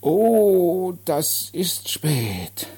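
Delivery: loud high "Oh", then slowly drawn out; booming simple-minded man. Acted. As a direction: react with slow, childlike dismay. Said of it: "sein dröhnender Bass". (langgezogen und bestürzt)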